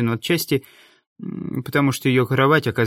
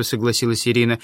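plain reading voice

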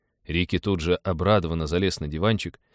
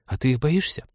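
put-on high child's question, rising at the end; curious little boy; 5 semitones higher than usual. A narrator's reading. Boy asks pilot if he's scared, curious high voice. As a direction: probe like a small boy.

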